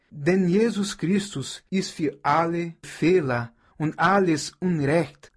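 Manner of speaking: normal talking voice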